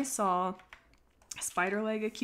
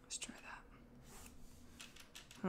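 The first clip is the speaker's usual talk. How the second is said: whispering; 17 dB quieter, much slower than usual. Real, not acted. Quiet focus while drawing.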